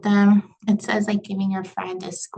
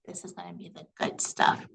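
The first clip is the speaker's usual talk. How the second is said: low, slow hush of a secret; soft, conspiratorial hush; 2 semitones lower, 9 dB quieter than usual. Shown as a demonstration. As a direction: lean in and hush it like gossip. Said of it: "they really hush their voice and they're talking low and slow".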